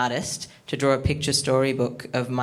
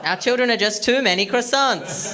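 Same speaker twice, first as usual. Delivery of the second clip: mock indignant; 8 semitones higher, 4 dB louder than usual.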